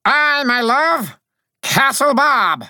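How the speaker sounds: high, loud little soldier calling out; drawn-out, high-pitched heroic call; thundering summons. A narrator's reading. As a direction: summon grandly in a piping voice. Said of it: "I thundered".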